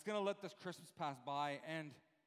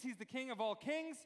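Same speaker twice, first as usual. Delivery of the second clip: normal talking voice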